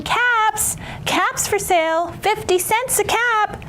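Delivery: high singsong peddler's cry; street vendor call. Acted. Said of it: "walked down the street calling".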